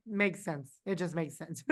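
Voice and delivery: soft, flat-toned resigned mutter; resigned murmur. Retold or imitated. Contestant watching rankings, softly resigned. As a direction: say it quietly to yourself, accepting. Resigned inner monologue quote.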